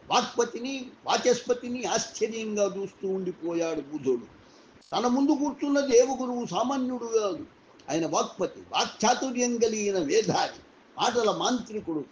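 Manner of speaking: plain reading voice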